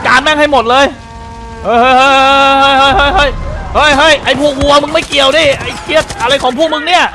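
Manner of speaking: furious yelling. Real, not acted. Gamer screaming at the cows rushing him, furious yell. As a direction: holler at the stampeding cows in real alarm.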